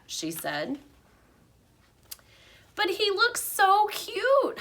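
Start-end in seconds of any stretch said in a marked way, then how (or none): 2.8-4.6 put-on high, slow little girl's voice; sweet plea, drawn out; eager child calling out. A narrator's reading. Heidi calls out to the teacher, high and drawn-out. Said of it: "Heidi called out".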